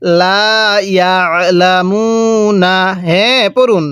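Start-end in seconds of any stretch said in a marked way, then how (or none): 0.0-2.8 লা ইয়ালামুনা with long vowels, throat-squeezed ain; slow model recitation. Shown as a demonstration. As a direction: press lightly in the throat on ইয়ালামুনা. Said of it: "আমার সাথে পড়ুন".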